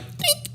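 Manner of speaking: tiny high squeaky dink; a tiny, very high ping of a sound. Retold or imitated. A small penis hitting a table, squeaked as a high dink. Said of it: "that's the sound it makes".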